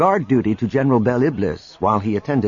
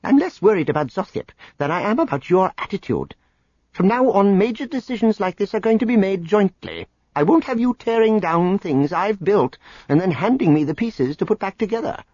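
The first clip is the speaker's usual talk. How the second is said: angry; 10 semitones higher than usual. Acted. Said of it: "Dizra glared at him".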